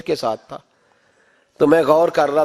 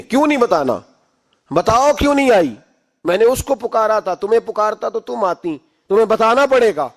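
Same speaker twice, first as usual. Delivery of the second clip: raised, high-pitched insistent questioning; angry demand; 5 semitones higher, 3 dB louder than usual. Retold or imitated. Angry father demands that his wife tell him why, angry. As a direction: press hard for an answer, indignant. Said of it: "غصہ آیا".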